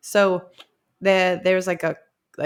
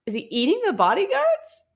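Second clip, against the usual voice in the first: high, incredulous mock question; mock alarm; 13 semitones higher than usual. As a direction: squeak it out in disbelief. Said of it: "And I'm like".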